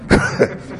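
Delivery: short voiceless chuckle. Real, not acted. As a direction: let out a brief, airy chuckle. Laughs during his own tale.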